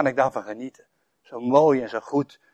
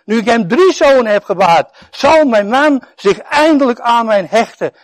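higher, louder hopeful voice; Lea's longing, lifted; Lea hoping; 6 semitones higher, 6 dB louder than usual. Acted. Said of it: "Daarom werd hij Levi genoemd".

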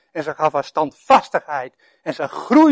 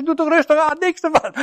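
much higher wife's voice; imitating wife; 11 semitones higher than usual. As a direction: mimic his wife urging calm. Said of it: "Mijn vrouw zei". (hoge stem van de vrouw die maant tot rust)

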